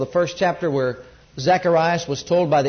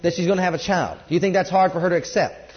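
ordinary delivery

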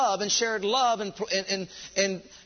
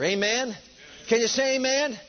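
ordinary delivery

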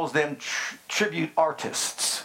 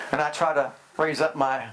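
usual speaking voice

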